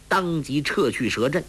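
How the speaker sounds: plain reading voice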